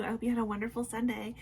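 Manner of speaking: ordinary delivery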